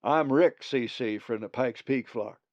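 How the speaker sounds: a Pikes Peak raven drawling his name; drawly accent. A narrator's reading. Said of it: "a peculiar drawly accent".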